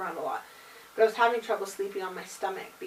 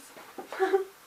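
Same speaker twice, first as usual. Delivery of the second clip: light sheepish laugh; quick embarrassed giggle; short laugh; 11 semitones higher, 3 dB louder than usual. Real, not acted. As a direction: laugh at herself. Laughs at forgetting.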